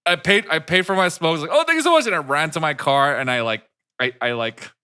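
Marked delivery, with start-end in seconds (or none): 1.5-2.1 high, loud, overpolite thanks; nervous thanks. Retold or imitated. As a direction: gush a nervous thank you and flee. Fleeing the store politely.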